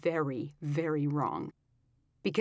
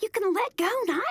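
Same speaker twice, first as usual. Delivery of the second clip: much higher, loud and pleading; high bride voice; 7 dB louder, faster than usual. A narrator's reading. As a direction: plead with him to let go. Bride voiced, being dragged.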